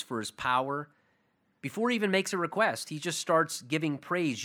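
ordinary talk, nothing marked

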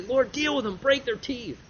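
mild mock fury at enemies; mock angry David. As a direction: play David's temper, lightly. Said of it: "David get angry".

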